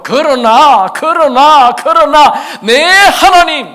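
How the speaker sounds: loud high slow cry building on 그러나; desperate cry to God. Acted. Preacher voicing David calling on God in distress, loud slow pleading.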